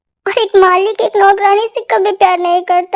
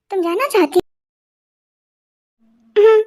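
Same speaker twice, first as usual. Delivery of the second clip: ordinary talk, nothing marked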